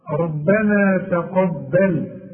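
"ربنا تقبل" slowly, with a full r and light t; measured recitation. Shown as a demonstration. Teacher modelling the verse, measured recitation. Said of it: "كل راء مفتوحة".

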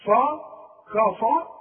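فا and كفا said thick and open, the vowel pulled back; wrong heavy vowel. Shown as a demonstration. Teacher showing the wrong, heavy كفا to a student. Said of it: "الكاف في عموم القرآن كمال".

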